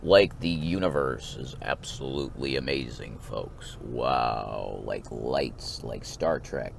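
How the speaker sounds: low, hushed cosmic wonder; Carl Sagan. Acted. Stargazer telling folks about the universe, awed low impression. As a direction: marvel softly at the universe in a low voice. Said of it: "it's Carl Sagan, folks".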